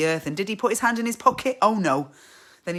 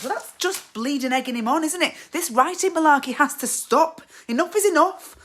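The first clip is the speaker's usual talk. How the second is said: higher, building louder to a snap; exasperated outburst; 8 semitones higher, 5 dB louder than usual. Acted. Fed-up wife on her husband's writing, exasperated. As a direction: rant rising to a loud breaking point.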